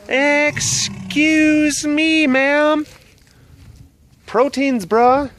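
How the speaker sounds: high, drawn-out mock scolding; playful pet talk. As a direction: address the pet in a high put-on voice.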